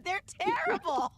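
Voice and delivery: laughing, high-pitched teasing; real laughter through the words. Real, not acted. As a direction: tease them while cracking up. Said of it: "laughing while teasing".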